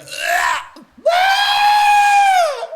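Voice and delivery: high, loud imitated baby wail; newborn's first cry. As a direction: cry out like a newborn gasping to life. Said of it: "The baby went".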